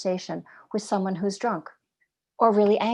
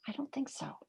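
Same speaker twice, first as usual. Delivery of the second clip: breathy whispered repeat, a hushed no; 4 semitones higher, 15 dB quieter than usual. Shown as a demonstration. Teacher to listeners, repeating her answer in a whisper. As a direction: drop it to a whisper, like a secret.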